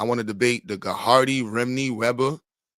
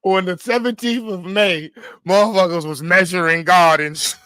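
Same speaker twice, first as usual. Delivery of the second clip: mock presenter; 4 semitones higher, 6 dB louder than usual. Acting a presenter.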